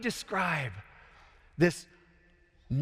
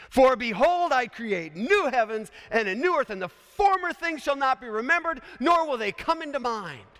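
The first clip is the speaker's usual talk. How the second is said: higher, louder scripture recitation; preached proclamation; 7 semitones higher, 5 dB louder than usual. Acted. Preacher quoting Isaiah's prophecy to chapel, proclaiming. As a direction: declare it like a prophet's announcement.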